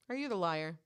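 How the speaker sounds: usual speaking voice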